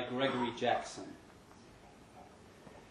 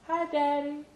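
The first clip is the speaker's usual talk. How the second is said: put-on much higher small child greeting; sweet little daughter; little girl's voice. A narrator's reading. Daughter Laylene greeting her dad, small and high.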